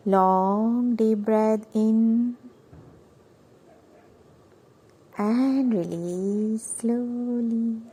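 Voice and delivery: extremely slow, elongated delivery; slow, sustained meditation instruction; breathing cue. Shown as a demonstration. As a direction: hold each vowel as the breath flows in and out. Cueing the last deep breath.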